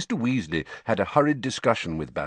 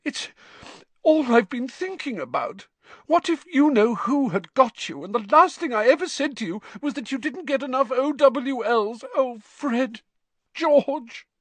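high-pitched, sobbing mother; distraught mother crying over her sons; tearful wailing; 4 dB louder than usual. A narrator's reading. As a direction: wail it out as the sobs take over. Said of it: "starting to sob".

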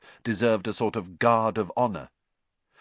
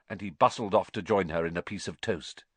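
ordinary delivery

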